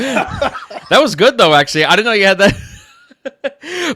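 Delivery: high, loud, amazed laughter through words; laughing. Real, not acted. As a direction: praise it while cracking up. Host cracks up at voice demo.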